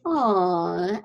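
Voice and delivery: ordinary talk, nothing marked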